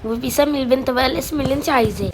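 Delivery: ordinary delivery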